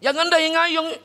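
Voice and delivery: high, brash loudmouth shout; loud brash complaining. Retold or imitated. Preacher acting out the loud companion Thabit, brash yelling. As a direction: blurt it out like a hothead who never lowers his voice. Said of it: "เขาเรียกโวยวายอะ".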